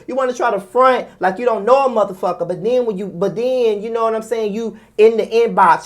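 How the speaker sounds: usual speaking voice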